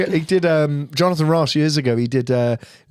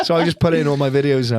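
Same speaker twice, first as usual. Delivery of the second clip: normal talking voice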